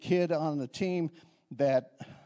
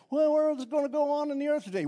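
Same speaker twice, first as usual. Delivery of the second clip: higher, lightly voiced asker; questioning voice; 10 semitones higher than usual. Pastor voicing a listener asking about the earth, mock questioning. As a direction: lift it like someone in the pews asking.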